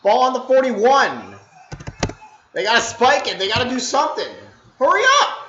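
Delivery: loud, high, urgent yelling at the screen; excited yelling. Real, not acted. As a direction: yell at the team to hurry, heart pounding. Watching a last-second drive live.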